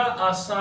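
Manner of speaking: आसा with the final सा held very long; long madd. Shown as a demonstration. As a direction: stretch the सा of आसा for five alifs. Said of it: "पांच अलिफ के बराबर खींचा जाता है". (आसा के सा को पांच अलिफ जितना खींचकर)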